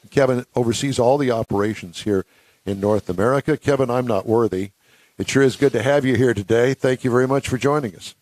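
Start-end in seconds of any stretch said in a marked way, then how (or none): none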